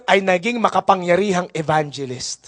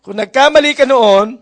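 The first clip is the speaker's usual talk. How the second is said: loud preacher's call; emphatic preaching; 5 semitones higher, 6 dB louder than usual. Real, not acted. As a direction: urge them to move on. Preaching climax. (malakas na panawagan)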